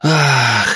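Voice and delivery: loud, high gasp; waking gasp. A narrator's reading. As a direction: gasp "ah" as if jolted awake. Daughter suddenly wakes up.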